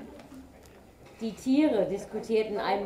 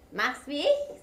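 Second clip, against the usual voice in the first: higher, odd badger voice; 7 semitones higher, 3 dB louder than usual. A narrator's reading. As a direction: play a lazy badger. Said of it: "sagte der Dachs".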